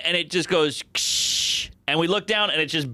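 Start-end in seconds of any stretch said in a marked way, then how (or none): 0.9-1.7 short hushed crashing "ksh"; glass smash noise. Retold or imitated. Storyteller imitating an ashtray hitting a windshield, crash noise. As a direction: imitate an impact with a burst of air.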